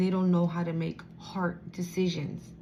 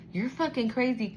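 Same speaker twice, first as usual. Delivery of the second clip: usual speaking voice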